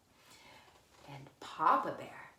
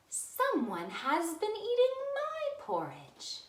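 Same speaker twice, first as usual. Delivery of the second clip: higher mother bear voice; Mama Bear voice; 13 semitones higher, 3 dB louder, slower than usual. Acted. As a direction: lift it up into a fussy mama bear.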